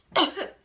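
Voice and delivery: one sharp loud fake cough; signal cough. A narrator's reading. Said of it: "Shang coughed".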